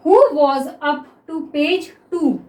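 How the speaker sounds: each word drawn out slowly; slow dictation. Shown as a demonstration. Teacher dictates the next sentence to students, very slow. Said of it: "next देखिए".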